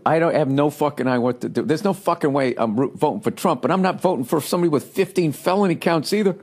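normal talking voice

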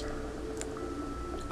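big audible intake of breath; long, excited gasp; sharp gasp. Real, not acted. Spots something in the game.

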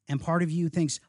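ordinary delivery

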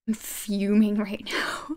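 slow, hushed whisper of contained rage; whispered fuming. Real, not acted. Streamer telling chat she is fuming, whispering. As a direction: whisper it through seething. Quietly seething after witch attack.

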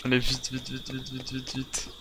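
ordinary talk, nothing marked

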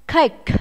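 Cake with a strong puff of air on the final K; aspirated ending. Shown as a demonstration. Teacher models Cake for pupils, final K aspirated. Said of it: "Chúng ta sẽ phải bật hơi ra".

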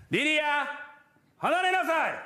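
calling her off sharply, mock stern; father shouting the kid's name, high; retold yell at daughter. Father yelling at toddler.

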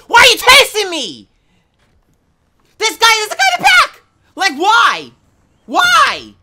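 panicked screaming; panicking gamer. Real, not acted. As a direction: yell in terror while chased. Panicking in game, being chased.

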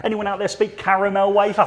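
laughing through a joke question. Real, not acted. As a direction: giggle while asking. Joking about mouthful, laughing.

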